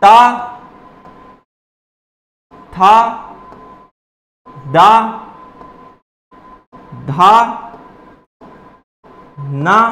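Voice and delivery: ता था दा धा ना sounded one at a time with pauses; letter drill; dental syllable demo. Shown as a demonstration. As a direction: say each dental sound crisply, separately. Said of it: "तो कौन कौन सा आ जाएगा आपका?".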